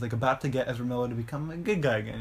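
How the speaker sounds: normal talking voice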